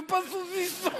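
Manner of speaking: « pas saucisson » aigu, le s zozoté; « saucisson » avec s en ch, voix d'enfant; zozotement. Retold or imitated. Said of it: "Non, monsieur". (zozotement d'enfant)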